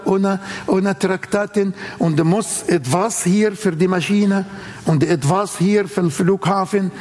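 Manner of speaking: normal talking voice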